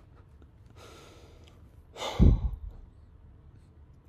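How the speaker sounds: long loud weary sigh; real exhausted sigh; heavy sigh. Real, not acted. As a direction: let out a long defeated breath. Despairing after fight.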